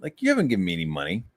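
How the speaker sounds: normal talking voice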